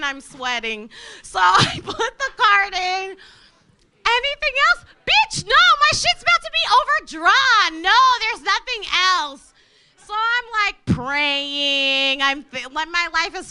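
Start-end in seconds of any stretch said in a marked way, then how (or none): 4.1-4.8 high cashier voice. Retold or imitated. Cashier asks at checkout. 5.0-9.5 panicked inner snap. Retold or imitated. Panicked inner reply at register.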